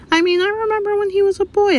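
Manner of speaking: a high fond barmaid's memory; Rosmerta reminiscing. A narrator's reading.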